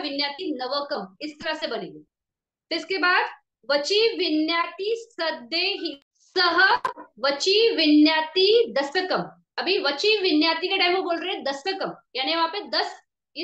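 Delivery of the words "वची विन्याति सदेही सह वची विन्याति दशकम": very slow, drawn-out chant-like reading; emphatic recitation. Shown as a demonstration. Teacher reciting the next category, slow emphatic recitation. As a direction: recite each word slowly, building louder.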